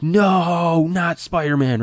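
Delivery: high, drawn-out dismayed wail; mock scream. Imitating moviegoers screaming during the Spider-Man scene, mock scream. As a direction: imitate a theater crowd wailing in dismay. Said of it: "so many people that were screaming".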